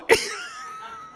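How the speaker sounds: high, breathless laughing fit; helpless real laughter, squeaking high; cracking up for real; burst of laughter. Real, not acted. Crowd moment, leader cracks up.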